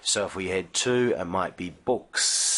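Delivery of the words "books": relax and let the s of books flow out; long gentle final s. Shown as a demonstration. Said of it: "that nice, long, gentle sound".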